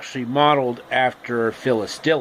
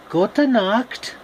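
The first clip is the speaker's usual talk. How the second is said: German goodnight; 8 semitones higher than usual. Acted.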